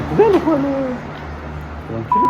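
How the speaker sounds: high startled exclamation; shocked outburst. Real, not acted. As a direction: yelp it in disbelief. Startled at the knocked bin.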